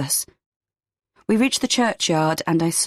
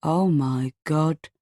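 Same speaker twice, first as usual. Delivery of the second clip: stunned; much slower than usual. A narrator's reading. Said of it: "his jaw literally drops".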